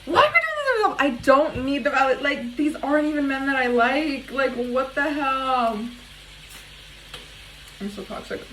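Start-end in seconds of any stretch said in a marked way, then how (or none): 0.1-0.9 way higher, louder, frustrated; exasperated high cry. Real, not acted. 7.8-8.4 softer, under the breath; mumbled admission. Real, not acted.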